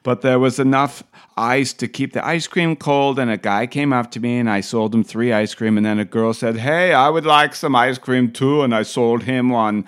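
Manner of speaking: hammed-up imitated Austrian accent; Arnold accent. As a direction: tell the tale in a mock Austrian accent. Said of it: "it's hard not to do the accent".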